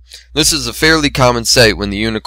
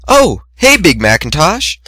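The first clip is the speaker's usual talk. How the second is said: high bright greeting call; sudden cheerful hello from behind; cheery call; 7 semitones higher, 3 dB louder than usual. A narrator's reading. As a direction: sing out his name brightly. Twilight calls out cheerily.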